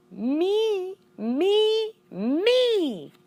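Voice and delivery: high squeaky echo of me; squeaky hamster echo. A narrator's reading. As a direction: ring it out high and bright like an echo. Hamster echoing himself emphatically.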